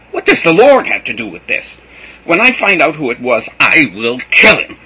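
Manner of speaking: rage, threatening to kill; jealous fiancé raging; furious rant. A narrator's reading. Joseph ranting in fury.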